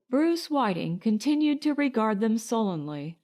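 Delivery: usual speaking voice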